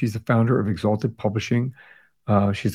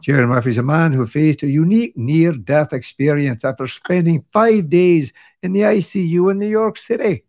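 put-on Scottish accent; 6 semitones higher, 4 dB louder than usual. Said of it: "You're never too tired to do a Scottish accent".

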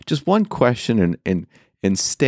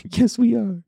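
mock echo; 13 semitones higher than usual. Real, not acted. Mocking echo.

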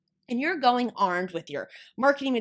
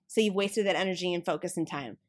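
ordinary talk, nothing marked

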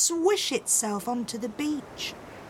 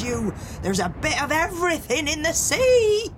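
high, swelling, exultant declamation; rousing shout; 8 semitones higher, 4 dB louder than usual. A narrator's reading. Pirate mother praising the sea to her son, shouting. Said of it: "she shouted to him".

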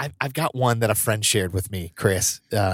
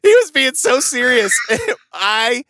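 high-pitched, loud laughing words; laughing hard; 11 semitones higher, 5 dB louder than usual. Real, not acted. Host cracking up recalling the serious compliment, laughing loud and high. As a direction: laugh through the line, higher and louder.

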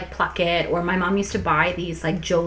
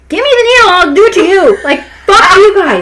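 furious yell; 11 semitones higher, 13 dB louder than usual. Retold or imitated. Teen furious at electrolysis pain.

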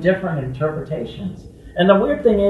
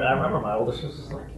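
ordinary delivery

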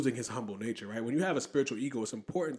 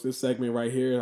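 normal talking voice

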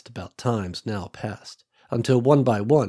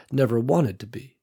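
plain reading voice

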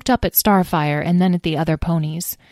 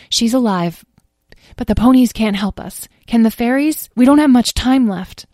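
anxious; 5 semitones higher, 5 dB louder than usual. A narrator's reading. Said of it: "Talora answered anxiously".

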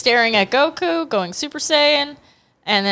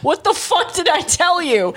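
high, exasperated mock scolding; hammed-up telling-off of a straying kid; car-scolding parent voice; 6 semitones higher than usual. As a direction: call out like a parent losing patience. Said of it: "get your ass back in the car".

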